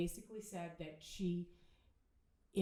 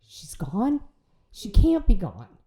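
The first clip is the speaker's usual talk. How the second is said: stunned disbelief; 2 semitones higher, 7 dB louder, slower than usual. Retold or imitated. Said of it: "I just kinda collapsed".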